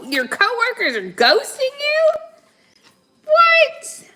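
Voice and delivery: loud incredulous what; shocked disbelief. Real, not acted. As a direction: react in outrage. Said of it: "reacting to card".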